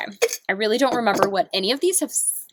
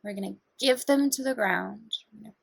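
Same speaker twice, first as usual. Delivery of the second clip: guided meditation; 5 semitones lower, 3 dB quieter than usual. Acted. Guiding viewers through breathing, in a calm meditation voice. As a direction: talk them gently through each breath.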